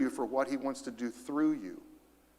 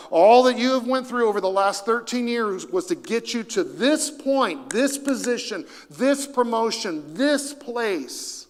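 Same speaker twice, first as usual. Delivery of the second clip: building fervor; 5 semitones higher, 4 dB louder than usual. Real, not acted. Building sermon climax.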